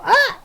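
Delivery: high, loud startled yelp; retold yell. Great grandpa yelping as he tumbles out the window, high and loud. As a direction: mimic a man yelping as he falls backwards. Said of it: "and then hear him go".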